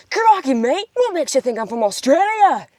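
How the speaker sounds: put-on big, high, swooping Aussie accent; passionate Australian accent. Showing how passionate Australians sound, hammed-up Australian accent. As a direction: ham up an impassioned Australian. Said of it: "they're, like, so passionate".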